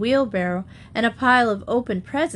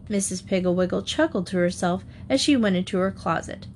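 usual speaking voice

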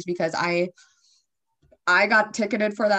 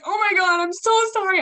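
retold crying apology; 12 semitones higher, 5 dB louder than usual. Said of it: "I was, like, literally crying".

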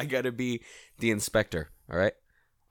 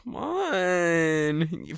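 higher, long-drawn plea; wheedling; 5 semitones higher than usual. Real, not acted. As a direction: drag it out to coax a reluctant friend. Coaxing the reluctant co-host.

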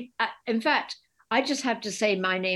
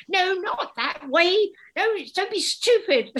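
high, loud, slow and laughing; playful shouting at her sketch artist; mock phone shouting; 11 semitones higher, 5 dB louder, slower than usual. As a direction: squabble down the phone, half laughing. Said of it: "shouting down the phone to each other".

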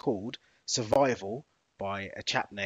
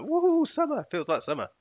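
excited whoop; 13 semitones higher, 6 dB louder than usual. Retold or imitated. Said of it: "you can be like".

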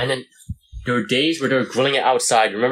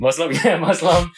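laughing; 5 semitones higher than usual. Real, not acted. Laughing at own mix-up.

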